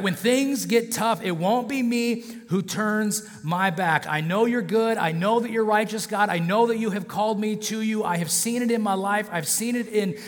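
high, insistent vow of loyalty; impassioned self-assured disciple; Peter. Acted. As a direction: swear devotion with rising conviction, acted out. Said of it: "that's Peter's thoughts here".

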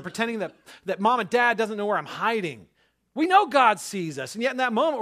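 high, loud, driving sermon voice; fervent preaching. Acted. Pastor preaching hard to his congregation, fervent and loud. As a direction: preach it out with full fire.

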